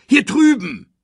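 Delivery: high, loud calling out; barked call. A narrator's reading. Pumba calls his friend over, barking high and loud. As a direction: bark it across to a friend. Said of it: "bellte er".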